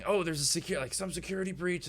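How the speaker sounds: high, excited shouts between guards. Acted. Guards running past with news of a breach, excited. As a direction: blurt it out in high-pitched alarm. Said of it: "excitedly talking to each other".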